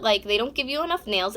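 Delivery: ordinary talk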